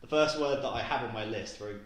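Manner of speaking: usual speaking voice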